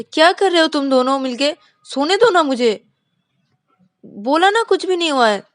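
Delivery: high, loud irritated whine; grumpy sleepy friend. Acted. Vikas waking up annoyed at his two friends, loud high whine. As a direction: brush them off, you want to sleep. Vikas woken, denies anything.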